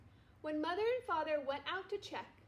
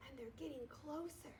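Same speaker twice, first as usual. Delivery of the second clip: much softer, hushed and tense; 10 dB quieter than usual. A narrator's reading. Arthur warning friends in the dark tent, hushed.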